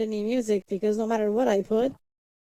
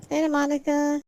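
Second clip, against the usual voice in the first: high cheerful greeting; 6 semitones higher than usual. Real, not acted. Greets another viewer.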